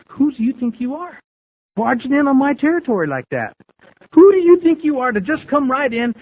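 high, rising indignant challenge. Retold or imitated. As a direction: confront a trespasser on your turf. Indignant inner challenge to rival.

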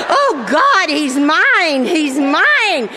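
high, swooping mock ecstasy; gloating glee. Wife gloats after husband's call for tow, gleeful. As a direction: crow it with hammed-up vengeful delight. Acting out glee at coming revenge.

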